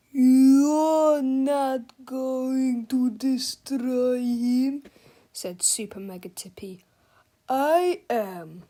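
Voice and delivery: slow, booming giant menace; giant robot villain. Acted. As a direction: speak like a huge menacing machine. Giant Super Mega Tippy voice.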